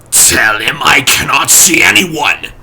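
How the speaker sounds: a hint of a strange complaining voice. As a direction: unseen master whining at the butler. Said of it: "it said complainingly".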